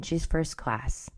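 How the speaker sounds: ordinary talk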